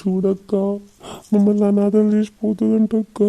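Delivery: overacted crying. Brother crying to his sister about being orphaned, overdone wailing. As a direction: wail it out with hammed-up grief. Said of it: "నాకంటే ఎక్కువ over action చేస్తుంది".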